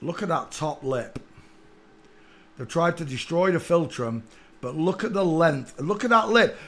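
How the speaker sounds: ordinary delivery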